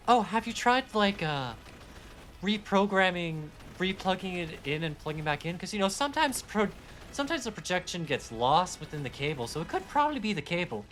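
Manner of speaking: high-pitched, put-on British accent; Doctor Who-style British character voice. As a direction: imitate a helpful British explainer, pitched high. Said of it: "say that in a British accent".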